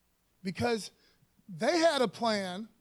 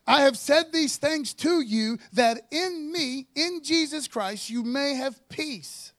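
forceful preaching read; 2 semitones higher, 5 dB louder than usual. Shown as a demonstration. Pastor proclaiming the verse to his congregation, loud and slow. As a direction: thunder the verse out to the room. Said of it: "Check this out".

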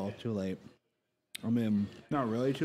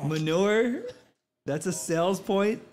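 high, loud disbelief; can't believe this is real; incredulous; 6 semitones higher, 5 dB louder, slower than usual. Real, not acted. Mocking outlandish tasting note.

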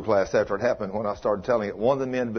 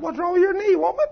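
mock teasing; 3 dB louder than usual. Teasing wife about her knee.

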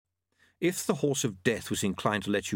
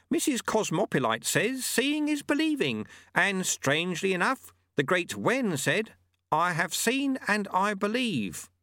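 high, deliberate master's voice; sage old monk; 11 semitones higher than usual. Acted. Monk citing sayings before swinging the sword, sagely. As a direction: quote the wisdom high, slowing toward the end.

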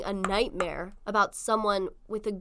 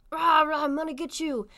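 lightly growled villain threat, raised pitch; mock menace; 6 semitones higher, 3 dB louder than usual. Shooter threatens dreamer in retold dream, mock menace. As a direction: play a cartoonish attacker.

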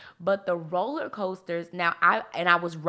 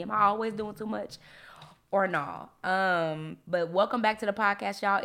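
ordinary talk, nothing marked

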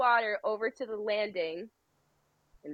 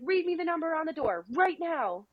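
high, demanding mock phone voice; urgent staff member; 7 semitones higher than usual. Ride staffer demanding her location by phone, mock urgency. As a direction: demand it, pitched up, as an imitation.

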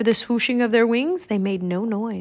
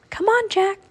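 high-pitched urging; eager croak; 11 semitones higher than usual. A narrator's reading. Annie urging Jack to follow Teddy, eager croak. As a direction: coax him along in a high voice. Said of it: "croaked Annie".

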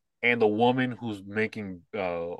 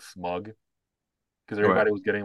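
ordinary talk, nothing marked

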